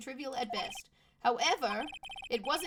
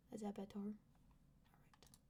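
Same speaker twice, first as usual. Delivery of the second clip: whispered under breath; 3 semitones lower, 19 dB quieter, slower than usual. Real, not acted. Whispered aside.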